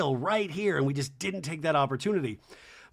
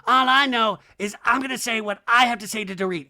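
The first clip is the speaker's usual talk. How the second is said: ordinary talk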